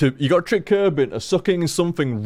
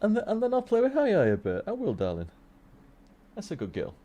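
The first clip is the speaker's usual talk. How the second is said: soft reassurance; 5 semitones higher, 8 dB quieter than usual. Real, not acted. Streamer promising to play with darling, soft and reassuring. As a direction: soothe and praise, much softer than usual. Soothing someone with endearments.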